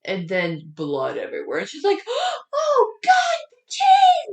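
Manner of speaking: high, loud horrified yelp; gasp, then wail it in mock horror; hammed-up shriek of discovery; panicked cry. Retelling Megan finding bloody cabin.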